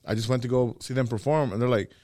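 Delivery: ordinary delivery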